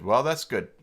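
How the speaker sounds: ordinary talk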